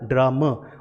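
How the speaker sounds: ordinary delivery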